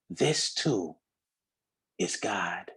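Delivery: low, soft whisper fading out; hushed whisper. Real, not acted. Preacher softly whispering the final line to the crowd. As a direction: let it fall to a whisper.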